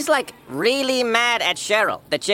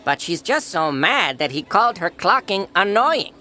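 normal talking voice

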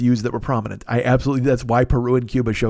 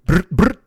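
louder brrr brrr like gunfire; gunshot imitation; 4 semitones higher, 4 dB louder than usual. Rap-song gunfire, louder rattling brrr. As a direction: rattle it off like shots. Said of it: "that's a shotgun".

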